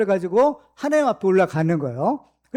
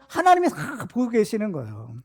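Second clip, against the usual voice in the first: usual speaking voice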